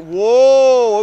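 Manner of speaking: sudden loud startled gasp; startled whoa. Real, not acted. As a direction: jolt of alarm, loud. Car nearly spins.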